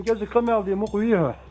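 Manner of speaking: ordinary delivery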